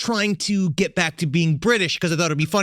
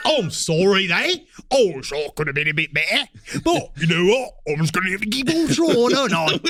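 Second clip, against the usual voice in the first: hammed-up British accent sliding into Australian; destroyed English accent. Shown as a demonstration. Apologizing to UK listeners, badly faked English accent. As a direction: mangle an apology in bad British. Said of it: "I've destroyed my English accent".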